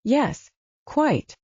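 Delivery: grated out through clenched teeth; grudging, teeth clenched; through gritted teeth. A narrator's reading. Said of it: "Through gritted teeth, Mister Hewlett grated".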